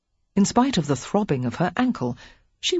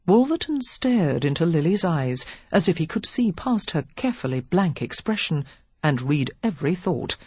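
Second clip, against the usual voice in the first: ordinary delivery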